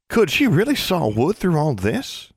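slow, high, disbelieving question; can't believe anyone sleeps through this; lightly voiced incredulous girl. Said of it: "Forbearance sounded incredulous".

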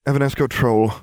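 usual speaking voice

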